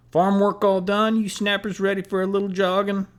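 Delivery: high, loud hayseed taunt; sneering country hayseed accent, called out; mocking hayseed accent. A narrator's reading. Moats mocking the runners in a loud hayseed accent. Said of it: "he shouted in his best hayseed accent".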